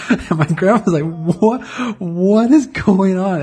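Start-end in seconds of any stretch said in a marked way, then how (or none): 1.0-3.4 high, drawn-out bewildered question; mock a panicked grandma, stretching it out slowly; imitated panic.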